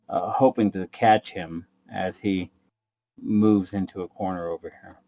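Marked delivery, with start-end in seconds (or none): none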